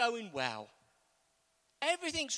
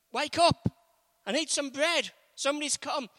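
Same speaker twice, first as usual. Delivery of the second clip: calling out; 4 semitones higher, 6 dB louder than usual. Acted. Man knocking at midnight.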